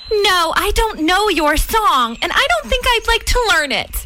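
high, quick haughty refusal; snooty young bird. A narrator's reading. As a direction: brush him off snootily. Billy refuses the new bird.

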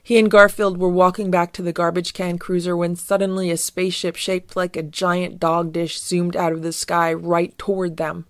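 usual speaking voice

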